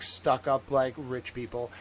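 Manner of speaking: ordinary talk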